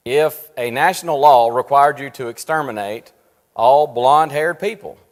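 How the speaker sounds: ordinary delivery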